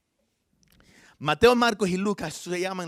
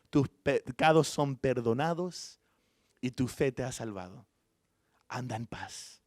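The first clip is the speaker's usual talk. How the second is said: slow opening, softening into peace; tender forgiveness; 4 semitones lower, slower than usual. Retold or imitated. Jesus forgiving the woman before everyone, gentle, falling to softness.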